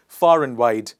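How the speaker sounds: loud "far-un-wide"; AND reduced between far and wide; repeat-after-me model. Shown as a demonstration. Teacher repeats for the class, loud with weakened AND. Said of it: "One more time".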